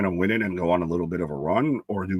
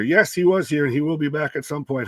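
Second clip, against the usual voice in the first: ordinary delivery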